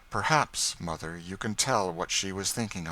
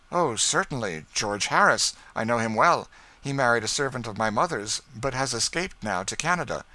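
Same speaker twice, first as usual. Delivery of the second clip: put-on louder, open young man; a hint of a cheerful young gentleman; young George; 5 semitones higher, 6 dB louder than usual. A narrator's reading. George answering freely.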